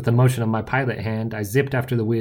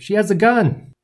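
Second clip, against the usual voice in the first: high, loud alarm; urgent warning shout; 11 semitones higher, 7 dB louder than usual. A narrator's reading. Narrator warns hero of armed leader, urgent shout. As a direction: shout a warning across the street. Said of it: "I shouted".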